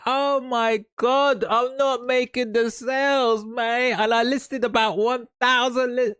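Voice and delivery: squeaky, flustered imitation of a new seller; high-pitched whiny impression of a rushed student; panicky seller. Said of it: "sometimes they'll be like".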